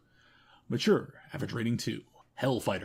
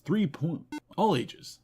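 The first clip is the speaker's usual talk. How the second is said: ordinary delivery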